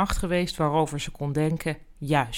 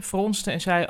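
plain reading voice

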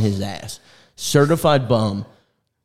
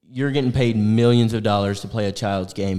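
ordinary delivery

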